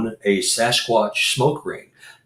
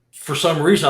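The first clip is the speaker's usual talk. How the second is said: usual speaking voice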